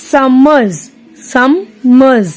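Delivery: Summers broken as "sum... mers"; split into syllables. Shown as a demonstration. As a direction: say Summers in two distinct beats, sum, mers. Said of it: "टू syllables".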